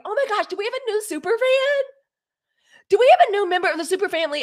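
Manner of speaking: high, loud, thrilled welcome; delighted squeal of welcome; excited greeting. Real, not acted. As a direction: gush over the new fan, fast. Greeting a new super fan.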